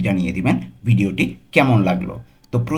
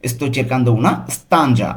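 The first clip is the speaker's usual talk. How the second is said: slow, careful Italian for learners; very slow model reading; 2 semitones higher, much slower than usual. Shown as a demonstration. Teacher models the phrase slowly for students. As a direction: spell it out slowly for the class. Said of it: "তাহলে পুরোটা কী হবে?".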